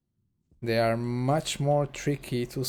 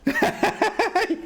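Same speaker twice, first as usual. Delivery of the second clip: high-pitched real laughter; 5 dB louder than usual. Real, not acted. Reacts to robot voice joke.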